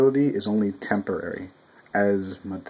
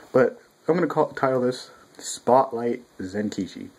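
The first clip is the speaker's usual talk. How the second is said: ordinary delivery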